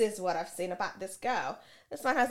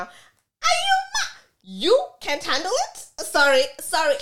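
incredulous outburst; 8 semitones higher, 9 dB louder than usual. Real, not acted. Reacting to boyfriend's excuse, shrill disbelieving outburst.

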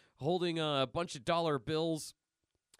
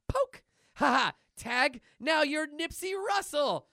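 high, loud cartoon taunt; gloating; 10 semitones higher, 5 dB louder than usual. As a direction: crow over the tag. Milk gloats after tagging Cheese.

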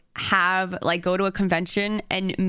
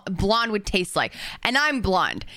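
normal talking voice

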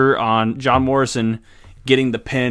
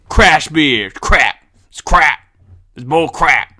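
loud; 5 dB louder, slower than usual. Real, not acted. Repeated crap verdict.

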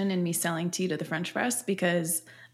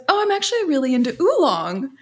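high quote; 7 semitones higher, 5 dB louder than usual. Retold or imitated. Husband quoted.

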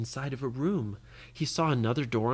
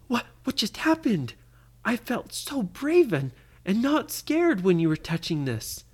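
puzzled wonder; 7 semitones higher than usual. Acted. Lydia amazed by the change.

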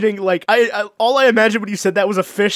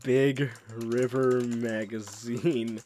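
deep announcer voice; 9 semitones lower, 12 dB quieter, much slower than usual. Pretend. Mock-grave echo of site name.

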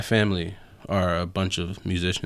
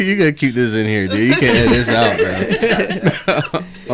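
laughing; 3 semitones higher, 7 dB louder, faster than usual. Real, not acted. Laughing, begging not to cut.

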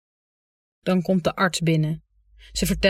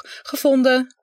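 triumphant; 7 semitones higher, 4 dB louder, slower than usual. Acted. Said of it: "Triomfantelijk houd ik".

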